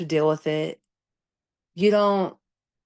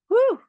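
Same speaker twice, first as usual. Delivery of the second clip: pained woo; 6 dB louder than usual. Real, not acted. Recalling the switch hurting.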